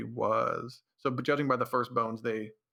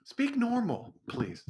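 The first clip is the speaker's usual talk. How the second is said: exasperated; 9 semitones higher, slower than usual. Real, not acted. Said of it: "Stop trying to make your voice".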